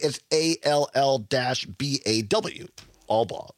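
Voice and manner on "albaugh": "Albaugh" as ALL-baw, low and even; correct surname pronunciation. Shown as a demonstration. Guest sounds out his phonetic spelling, "Albaugh" as ALL-baw. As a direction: say "Albaugh" in two syllables, all then baw. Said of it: "if I spell it phonetically".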